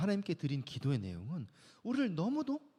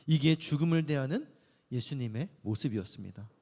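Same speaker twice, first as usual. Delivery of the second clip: normal talking voice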